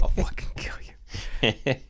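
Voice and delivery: slow whispered fake threat; mock whispered threat. Real, not acted. Speaker to friend Chris, mock whispered threat. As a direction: hiss a joke threat.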